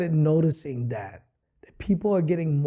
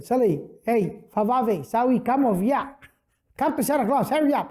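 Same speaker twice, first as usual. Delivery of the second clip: put-on Samoan Santa accent; 7 semitones higher than usual. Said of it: "used a Samoan accent".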